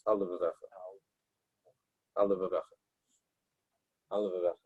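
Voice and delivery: al levavcha, the L of al held apart from the L of levavcha; separated al levavcha. Shown as a demonstration. Teacher demonstrating a careful pause between the L sounds. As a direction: show the pause between the two L's of al levavcha. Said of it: "it ends with an L sound, and it starts".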